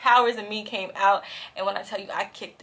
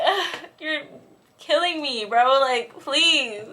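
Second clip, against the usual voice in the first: higher, whiny retold plea; exasperated begging; 5 semitones higher, slower than usual. Begging best friend to sit in the middle, exasperated. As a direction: groan and beg your friend.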